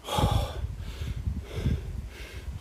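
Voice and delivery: long, soft breathless exhale; heavy panting breath. Real, not acted. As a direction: blow out air after the uphill. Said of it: "just catching my breath".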